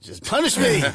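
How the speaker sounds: high laughing mock plea. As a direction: squeal it through a laugh.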